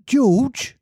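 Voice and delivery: high pained exclamation of George!; a wife scolding her husband, pained. A narrator's reading. Missus Darling pained at her husband, high exclaim. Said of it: "Missus Darling exclaimed".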